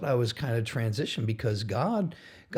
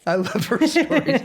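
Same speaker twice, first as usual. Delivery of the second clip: much higher and louder, beaming; delighted gush; 14 semitones higher, 8 dB louder than usual. Real, not acted. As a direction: rave about her fondly. Gushing about the little girl.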